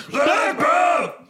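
distant shout away from mic; far from mic. Shown as a demonstration. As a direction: yell it from too far away. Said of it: "You gotta get closer to the mic".

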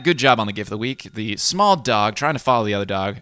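ordinary talk

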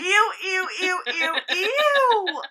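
loud, high disgusted ews. Real, not acted. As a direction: squeal ew in disgust. Disgust at squid.

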